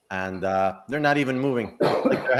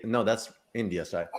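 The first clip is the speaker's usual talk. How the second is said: normal talking voice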